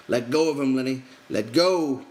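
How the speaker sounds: urgent shout. A narrator's reading. George orders Lenny to release Curly, shouting. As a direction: order him to let go.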